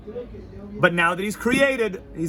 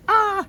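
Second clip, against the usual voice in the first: a sudden very high cry; ecstatic cry; 4 dB louder than usual. Retold or imitated. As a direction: burst out as if leaping in. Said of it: "somebody's like".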